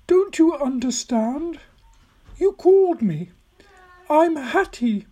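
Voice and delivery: put-on very high, imploring old lady; old woman, emotional. Old woman revealing herself to Tom, high and emotional. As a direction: plead to be recognised, very high.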